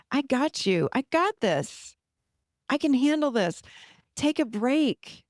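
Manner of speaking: strong reassurance. Shown as a demonstration. The someone we wanted as little kids, strong reassurance. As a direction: take charge with steady power. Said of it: "say it with your power".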